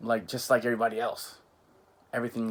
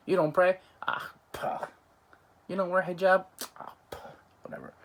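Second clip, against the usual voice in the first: scornful dismissal; 7 semitones higher than usual. Retold or imitated. Imitating scornful judging of others.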